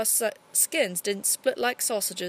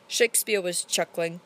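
ordinary delivery, nothing marked

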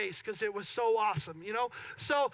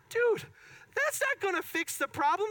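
incredulous; 8 semitones higher than usual. Retold or imitated. Mocking mechanic's Windex fix.

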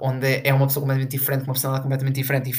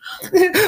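high shrieking laugh; 5 dB louder than usual. Real, not acted. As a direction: burst out in high, loud laughter. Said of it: "like, fuck you". (gargalhada aguda e alta)

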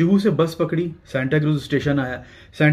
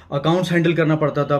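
ordinary talk

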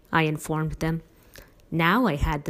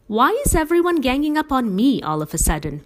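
indignant, raised demand; 8 semitones higher, 5 dB louder than usual. A narrator's reading. Father cornered by family at dinner, loud indignant demand. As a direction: push the question hard, feeling cornered. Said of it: "he demanded".

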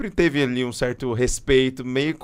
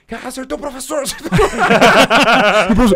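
high, laughing schoolkid taunt; mocking students; 10 semitones higher, 7 dB louder than usual. Students taunting the teacher who got kicked, high and laughing. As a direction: jeer it like a kid in class.

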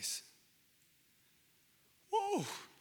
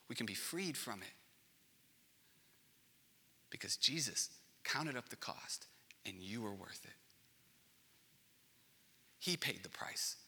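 soft, unvoiced whisper; 2 semitones lower, 8 dB quieter than usual. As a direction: whisper it like a secret of grace. Tender whispered assurance.

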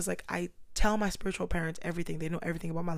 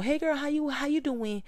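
higher breezy hello; surface-level greeting; 8 semitones higher, 4 dB louder than usual. Retold or imitated. Casual friend greeting her, higher and breezy. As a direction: toss off a shallow hey girl. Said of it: "a surface level".

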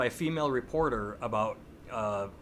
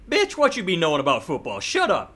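louder and higher, brash mock put-down; mock crude insult; 5 semitones higher, 8 dB louder than usual. Host imagining what Newton didn't say, loud mock rudeness. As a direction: bark it out like a rude jock.

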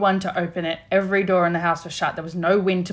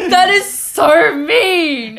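drawn-out high whine, louder; mock offended; 7 semitones higher, 8 dB louder, much slower than usual. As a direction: stretch it out, playfully hurt.

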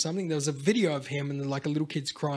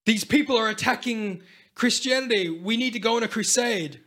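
mock call to arms; 7 semitones higher, 4 dB louder than usual. Church leaders calling for crusade.

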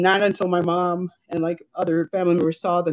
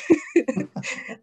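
high laugh; 9 semitones higher than usual. Real, not acted. Laughing about her Barbies.

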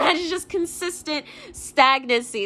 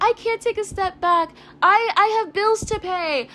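higher-pitched whiny excuse-maker; mocking complaint; 6 semitones higher than usual. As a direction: imitate someone pleading their excuses.

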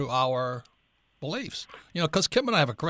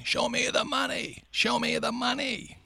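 high, drawn-out yell held back in volume; stretched-out high mock shout; 10 semitones higher, slower than usual. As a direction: imitate a movie yell, slow and high-pitched without full force. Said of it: "the guy is yelling".

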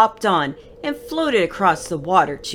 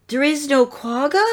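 usual speaking voice

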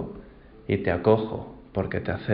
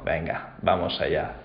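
hushed, softer inner voice; thinking it to himself under his breath; quiet resolve spoken inwardly; inner thought; 5 semitones lower, 5 dB quieter than usual. Acted. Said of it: "Pensó".

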